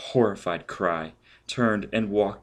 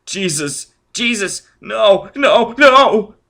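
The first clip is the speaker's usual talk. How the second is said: shrill, much higher, louder screams rising; panicked shrieking, growing louder; hysterical screaming; 13 semitones higher, 11 dB louder, much slower than usual. Acted. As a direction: scream in terror, climbing each time. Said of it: "Forrest began to scream hysterically".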